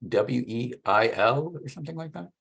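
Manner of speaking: ordinary delivery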